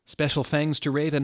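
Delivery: usual speaking voice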